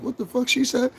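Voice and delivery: high, baffled what-did-she-say; high-pitched disbelief; confused reaction. Retold or imitated. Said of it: "I was like".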